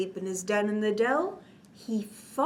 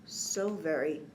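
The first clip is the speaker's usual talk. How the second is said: fading; 7 semitones lower, 10 dB quieter than usual. A narrator's reading. Sleepy bear fading out.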